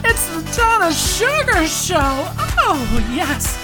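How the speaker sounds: high breathy diva host voice; femme fatale. Acted. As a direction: purr it like a glamorous showgirl. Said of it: "Oh, God, this voice. I sound like the author".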